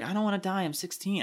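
high, soft imitation of a scared teenager; mock-upset teen, softly; retold distraught teen. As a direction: plead it small and high, lightly acted. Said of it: "she was like".

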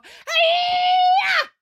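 very high, shrieking war cry; battle scream. Acted. April screams as she crash-tackles Loretta, shrieking. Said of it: "a terrifying scream of".

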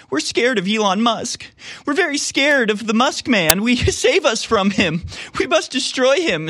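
high, mocking whiny voice; mock scared whine. Mocking opponents' fear of Musk.

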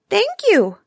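a small grateful mouse squeaking thanks; squeaky mouse voice. Acted. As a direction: speak as a little mouse. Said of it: "in a little squeaky voice".

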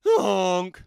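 loud goose honk. A narrator's reading. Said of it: "said Goose".

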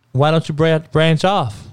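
normal talking voice

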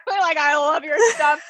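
high, squeaky with laughter; laughing quote. Retold or imitated. Quoting a boss who turned out a fan, laughing. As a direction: barely hold back laughter while quoting.

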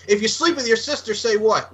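lightly acted wrestler's heckle; Undertaker. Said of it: "he's like".

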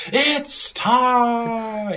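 boxing announcer's big intro line; Bruce Buffer. Retold or imitated. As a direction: say it like a fight announcer. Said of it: "Bruce Buffer is".

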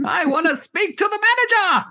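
a customer demanding the manager; hammed-up entitled yell; entitled complainer. Mocking the fun police.

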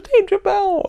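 high, loud squeal of recognition; excited woman's greeting. Retold or imitated. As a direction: cry out the name like a thrilled fan. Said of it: "she got all excited".

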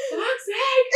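high, loud frustrated outburst; mother losing it on the school run, high exasperated curse; exasperated swearing. Retold or imitated. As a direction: let the frustration burst out. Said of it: "I was getting so mad".

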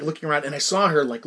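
ordinary talk, nothing marked

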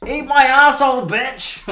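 high, loud imitated yell in church; mock scream. Teen imagines yelling in church, mock scream. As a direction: belt it out like a cheeky teen. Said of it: "just at the top of my voice".

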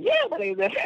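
put-on a young boy answering the dance teacher, high and eager; high kid voice. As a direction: answer brightly in a small boy's high voice. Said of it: "I was like".

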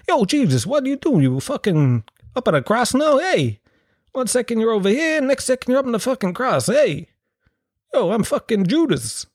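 put-on high-pitched, thick New York accent; Harvey Keitel. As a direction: ham up a New York mafia guy, voice way up high. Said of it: "Harvey Keitel is going".

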